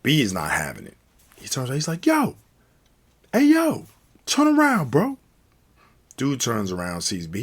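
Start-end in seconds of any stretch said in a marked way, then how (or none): 2.1-5.2 high, loud challenge to a stranger; acted-out street confrontation; confrontational call-out. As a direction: call him out sharply, pitched way up. B confronts the foreigners in retelling.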